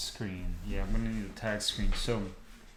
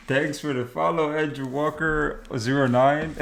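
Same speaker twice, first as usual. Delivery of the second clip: rattled laughing thanks; 3 semitones higher, 7 dB louder than usual. Real, not acted. Still rattled after startle.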